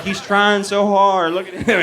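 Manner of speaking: lightly voiced God chuckling at a try-hard; amused God. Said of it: "where God's like".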